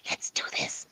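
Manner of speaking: Let's do this whispered, no voice. Shown as a demonstration. Investigator showing a tagless whisper, whispered. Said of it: "but you just whispered it".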